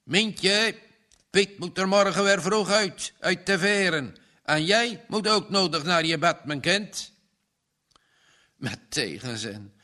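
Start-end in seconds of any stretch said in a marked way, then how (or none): 0.0-7.1 father calling out, higher and louder; warning call. Acted. Farmer Bart calls his daughter to bed, raised warning. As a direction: call her in from the shed. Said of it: "de stem van boer Bart waarschuwde".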